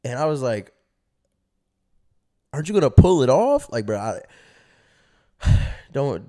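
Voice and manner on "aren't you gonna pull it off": loud, high shocked question; incredulous burst, pitched up; alarmed disbelief. Retold or imitated. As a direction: act out naive alarm. Naive kid alarmed in retold talk.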